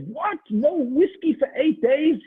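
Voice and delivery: learning it lasts eight days, shouting in horror; outraged disbelief. Acted. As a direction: cry out in horror at the news. Chaim shocked, eight days.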